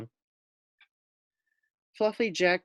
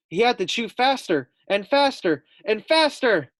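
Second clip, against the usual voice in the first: higher and louder, racing urgency; building excitement; 8 semitones higher, 5 dB louder than usual. A narrator's reading. Reading the climax to first graders, building excitement. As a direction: build the suspense to a peak.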